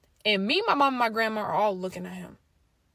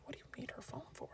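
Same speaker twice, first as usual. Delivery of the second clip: whispered, much softer; whispered thought; 3 semitones higher, 21 dB quieter, faster than usual. Retold or imitated. Narrator suspicious of her brother, whispering. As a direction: whisper it to yourself. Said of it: "I'm thinking".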